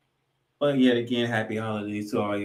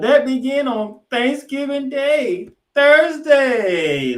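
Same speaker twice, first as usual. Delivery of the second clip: higher, louder, slow showman build; a burst of announcer hype; hyped announcer; 11 dB louder, much slower than usual. Acted. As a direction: sell it to the crowd. Host's hyped announcer flourish.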